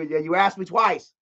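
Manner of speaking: ordinary talk, nothing marked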